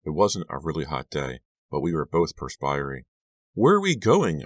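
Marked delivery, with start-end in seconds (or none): 3.5-4.4 high, louder, pressing question; acted insistence, pitched well up; insistent repeated question. A narrator's reading. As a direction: press for the answer you didn't get. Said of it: "I repeated my question".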